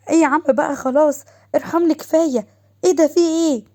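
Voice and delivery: high, loud playful protest; mild shrieking. A narrator's reading. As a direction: cry out as if losing a game. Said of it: "وضحى كانت بتصوت".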